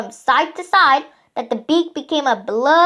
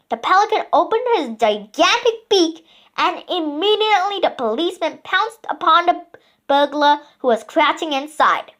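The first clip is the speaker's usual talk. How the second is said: ordinary delivery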